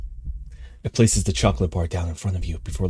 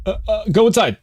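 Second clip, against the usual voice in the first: panicky; 13 semitones higher, 3 dB louder than usual. Real, not acted. Surrounded by judging cats.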